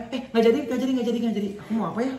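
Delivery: high, hurried mock dithering; flustered girlfriend. Girlfriend cancels her noodle order at the stall, high flustered mock voice. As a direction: imitate someone backtracking in a high voice, half laughing.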